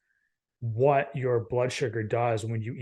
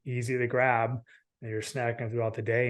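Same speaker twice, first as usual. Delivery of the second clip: normal talking voice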